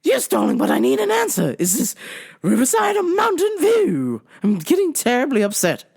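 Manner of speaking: high, huffy English darling; mock-English caller getting upset; phony English accent. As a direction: insist in a fake English voice. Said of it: "phony English accent".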